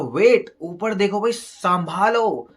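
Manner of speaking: high, loud acted alarm; shouted warning. Gawan's son warns the approaching giants, shouting. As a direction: yell a warning, stretching the last word. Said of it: "गवान का बेटा चिल्लाता है".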